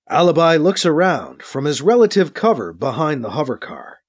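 ordinary delivery

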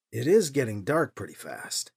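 ordinary delivery

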